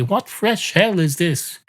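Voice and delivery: slow, high-pitched mock dismay; stagey imitation of an exclamation; quoting young people. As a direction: draw out the catchphrase theatrically. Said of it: "They say".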